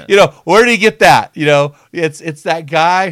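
laughing outburst. Real, not acted. Host cracking up at the kid's punchline, loud and high. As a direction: burst out in amused disbelief.

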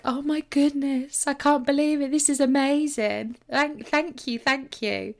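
hand-on-heart delighted gasping thanks; gushing gratitude. Retold or imitated. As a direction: swoon over a gift that isn't yours. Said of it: "hand on my chest".

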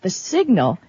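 ordinary talk, nothing marked

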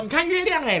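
mock moon-spotting exclamation. Friend showing how one points at the moon, mock excited. 模仿指月亮时的惊呼.

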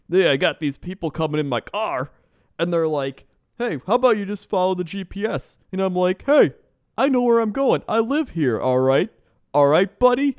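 high, loud mock Canadian rant; hammed-up Canadian accent, worked up. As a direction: rant like an indignant Canadian driver. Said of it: "need you to use that accent".

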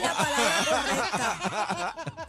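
slow, laughing correction; amused and drawn out. Real, not acted. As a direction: insist through real laughter. Correcting the word while laughing.

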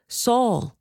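high, distant call of the name; a faraway heavenly voice calling. A narrator's reading. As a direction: call down from heaven, far away. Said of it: "heard a voice saying to me".

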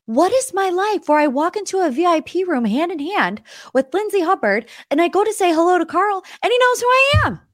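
high, rising excited rant; giddy disbelief. Retold or imitated. Morgan marveling at her night, high giddy disbelief. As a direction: spill it out giddy. Incredulous retelling.

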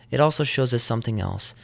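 usual speaking voice

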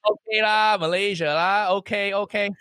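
put-on comic Malaysian accent with sing-song "lah"; Uncle Roger. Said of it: "let's say Malaysia for now".

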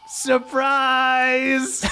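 awkward, high party shout of surprise; spring the surprise, a little sheepish; shy surprise. Acted. Dwarves popping out to surprise their friend, high cheer. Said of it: "they look up at you and they go".

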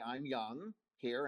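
usual speaking voice

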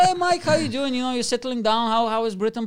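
imitating a sociable British workmate; friendly colleague. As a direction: greet the new guy with hammed-up cheer. Voices colleague's friendly greeting at work.

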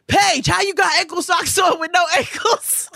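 very high, mocking heckle; taunting boy. Boy riding by heckles her sister, taunting. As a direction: call it out high like a kid teasing.

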